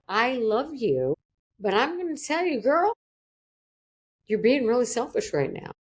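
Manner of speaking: the loving therapist pressing her point warmly; tender but firm. Retold or imitated. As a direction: say it with love, then tell her straight. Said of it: "she just kept that eye contact with me".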